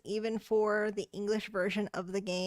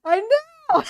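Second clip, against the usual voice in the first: excited; 10 semitones higher, 9 dB louder than usual. Real, not acted. Streamer cheering at game.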